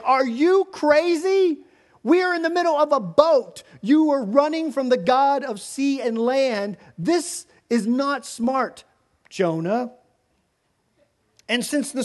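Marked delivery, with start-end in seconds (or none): 0.0-9.9 much higher and louder, incredulous scolding; exasperated sailors. Acted. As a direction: yell at the fool who brought the storm. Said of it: "they're like".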